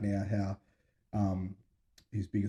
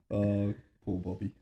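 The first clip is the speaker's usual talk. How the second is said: usual speaking voice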